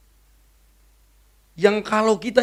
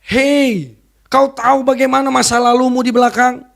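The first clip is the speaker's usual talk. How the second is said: lightly voiced accusing devil, higher and louder; 4 semitones higher, 4 dB louder than usual. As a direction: taunt like the devil whispering accusations. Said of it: "Iblis bilang begini". (suara iblis yang menuduh, sedikit lebih tinggi dan keras)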